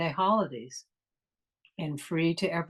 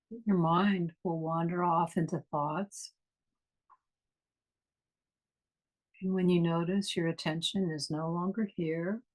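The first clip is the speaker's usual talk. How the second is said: guided meditation. Acted. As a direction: ease them inward, unhurried and calm.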